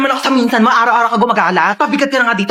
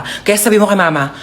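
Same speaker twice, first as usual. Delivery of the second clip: ordinary talk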